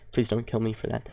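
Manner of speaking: usual speaking voice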